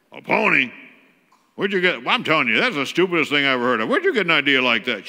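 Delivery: loud, fast exasperated mom scolding; angry mom. Retold or imitated. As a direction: berate the kid for a silly request. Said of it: "She's got a deep voice".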